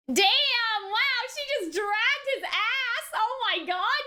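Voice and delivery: ordinary delivery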